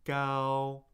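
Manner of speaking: Gao held on one level pitch; sing-steady first-tone Gao. Shown as a demonstration. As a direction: sustain "Gao" level, no rise or fall. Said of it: "find your first tone".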